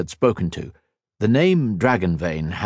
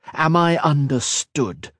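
slow, higher-pitched stern question; growled demand; 7 semitones higher, slower than usual. A narrator's reading. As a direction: demand obedience, drawing the words out slowly. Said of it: "he growled".

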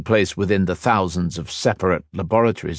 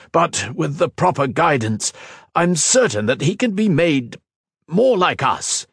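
insistent hope; 9 semitones higher than usual. A narrator's reading. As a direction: insist he can change. Tamar insisting.

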